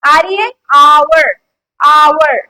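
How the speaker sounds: आवर said slow and loud; teacher driving home the vowel sound; emphasised word. Shown as a demonstration. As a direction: lean hard on आवर. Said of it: "आ की sound".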